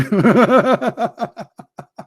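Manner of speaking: high laugh. Real, not acted. Laughs at own story.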